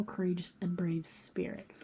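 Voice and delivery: tender hush; whispered. Real, not acted. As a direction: let the praise fade to a whisper. Affirmation trailing to whisper.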